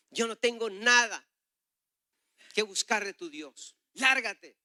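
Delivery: loud, high, emphatic dismissal; retold outburst, slow and forceful, ending in a loud order; angry rejection. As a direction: hurl it like you want them gone. Man angrily rejects God, dismisses him.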